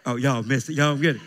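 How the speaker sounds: ordinary talk, nothing marked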